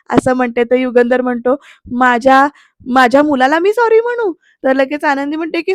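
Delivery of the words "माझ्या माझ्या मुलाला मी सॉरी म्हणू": incredulous. Retold or imitated. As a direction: balk at the very idea, pitch climbing. Father balks at apologising to son.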